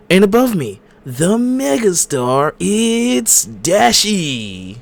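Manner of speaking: high, loud hype-man intro; announcer intro. Acted. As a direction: announce the show with big energy. Hosts podcast intro.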